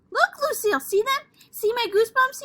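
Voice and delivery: very high and loud; excited shout. Acted. Sheldon shouts to Lucille about his goosebumps, high and loud. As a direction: call out eagerly to Lucille.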